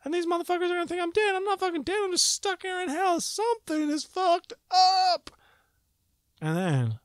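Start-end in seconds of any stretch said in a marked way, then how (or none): 0.0-5.3 much higher, louder, faster frantic rant; rising panic. Retold or imitated. Fearing his wife calls police, a frantic high rant. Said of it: "And I was like".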